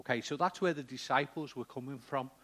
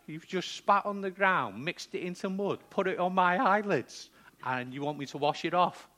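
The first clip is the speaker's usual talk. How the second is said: acted indignation; 6 semitones higher, 5 dB louder than usual. Acting indignant blind man told to wash.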